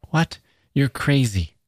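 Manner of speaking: put-on high, startled girl's voice; alarmed disbelief. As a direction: recoil with a high-pitched, incredulous start. Startled girl answers menacing stranger.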